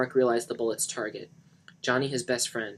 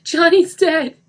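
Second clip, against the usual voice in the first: high, very loud anguish; crying out; 16 dB louder, slower than usual. A narrator's reading. Denny seeing the corpse, crying out. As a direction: scream out the loss. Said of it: "he cried".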